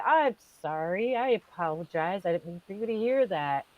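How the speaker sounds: soft, contained mock-proper apology; composed polite apology. Normal person apologizing to the priest, composed and soft. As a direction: apologize quietly and evenly, as normal people would. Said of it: "normal people would look at the father standing there and say".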